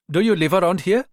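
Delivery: polite stranger with a Ghanaian lilt; Ghanaian accent. Acted. Rita in disguise stopping the young mother, Ghanaian accent. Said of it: "staying with the Ghanaian accent".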